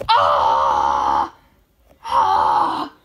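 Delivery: low monster-like scream; deep scream. Acted. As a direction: let out a deep roar. Puppet attacked, screaming.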